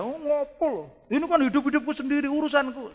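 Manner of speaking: high, fast, defiant mock retort; hard-headed retort. Preacher mocks a stubborn believer rejecting God's rebuke, high and fast. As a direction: act out the stubborn one brushing off correction. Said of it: "wow, keras".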